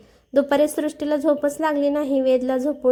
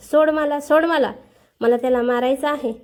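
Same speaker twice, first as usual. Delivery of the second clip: plain reading voice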